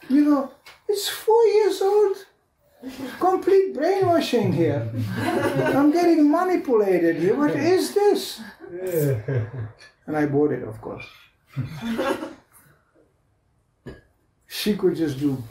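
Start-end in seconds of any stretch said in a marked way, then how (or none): 0.0-10.1 high, laughing disbelief. Real, not acted. Father laughing at his daughter's manipulation, high and laughing. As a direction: laugh through it, pitched up high.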